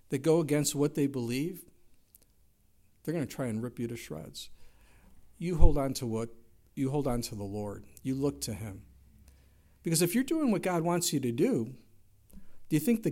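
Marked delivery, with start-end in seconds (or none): none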